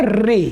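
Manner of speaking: री said as r plus long ee; common mispronunciation री. Shown as a demonstration. Teacher showing what some people say, wrong री. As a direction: say री with a plain long ee ending. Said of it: "कुछ लोग इसको बोलते हैं".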